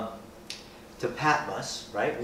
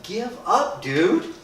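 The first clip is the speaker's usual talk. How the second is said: much higher, louder exhortation; preaching at full force; 11 semitones higher, 6 dB louder than usual. Acted. Preacher urging Paul to quit and go home, much higher and louder. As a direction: plead with Paul to give up.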